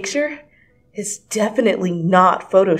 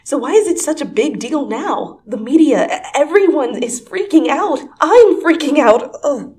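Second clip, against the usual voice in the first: higher and louder, building panic; frantic rising panic; freaking out; 8 semitones higher, 8 dB louder than usual. Acted. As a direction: spiral into a frantic outburst. Heroine panics aloud.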